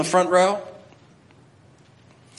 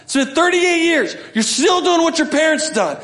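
much higher, louder enraged yell; angry shout; 13 semitones higher, 5 dB louder than usual. Retold or imitated. Moses yelling at the rebels before striking, furious. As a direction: blast it at them, fed up. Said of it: "in his anger".

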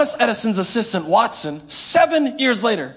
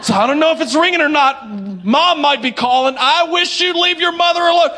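mock bickering; 5 semitones higher, 4 dB louder than usual. Acted family squabble.

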